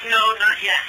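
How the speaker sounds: normal talking voice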